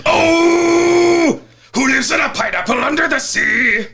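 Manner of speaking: high shouting pirate sing-song; pirate captain. Pretend. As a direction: belt it like the cartoon captain. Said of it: "SpongeBob intro chant parody".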